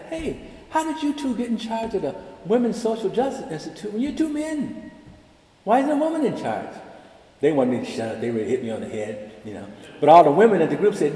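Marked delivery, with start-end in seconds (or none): 0.1-6.8 high, pointed question; mock incredulous challenge. Dean challenging the two men, high and pointed. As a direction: raise it high in disbelief. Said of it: "I said".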